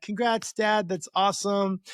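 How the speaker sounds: put-on high, bright girlish congrats; imitating daughter Annabelle. Daughter congratulating her dad on the call, high girlish mock voice. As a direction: chirp it like a happy teen daughter. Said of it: "Annabelle, she's like".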